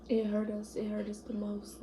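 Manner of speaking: normal talking voice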